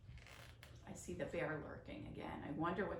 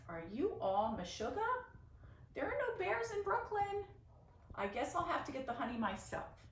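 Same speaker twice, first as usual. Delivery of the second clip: indignant disbelief; 8 semitones higher than usual. A narrator's reading. Grandma scolds Grandpa about bears in Brooklyn, scoffing. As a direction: scoff at the silly idea.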